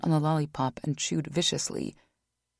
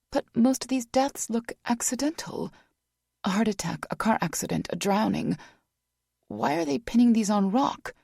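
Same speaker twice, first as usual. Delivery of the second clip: higher female voice; 6 semitones higher than usual. Acted. Vanessa voiced, raised pitch.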